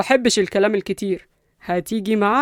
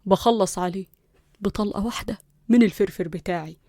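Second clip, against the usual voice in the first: softly spoken cool threat; hushed, unruffled menace; cold, into her ear; 6 dB quieter than usual. Acted. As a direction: threaten coolly, close and quiet. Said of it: "قرب من ودنها ببرود".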